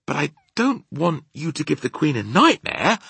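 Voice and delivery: put-on high, pleading little girl's protest; distressed cry. A narrator's reading. Sophie objects to the nightmare, high distressed protest. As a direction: protest high-pitched and upset. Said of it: "Sophie cried".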